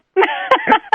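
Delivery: squeaky high laugh. Real, not acted. Finishing the [name] story, squeaky laugh.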